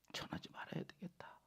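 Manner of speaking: hushed private resolve; whispered to himself. Retold or imitated. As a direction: mutter it under your breath. Jeremiah's inner resolve. (예레미야의 혼잣말, 속삭이듯)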